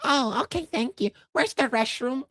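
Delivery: high, polite customer voice; light, high-pitched mimicry of a tourist; park visitor. Voicing a theme park visitor.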